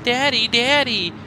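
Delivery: put-on high-pitched kid calling daddy; child's voice. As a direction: play a little child pestering a parent.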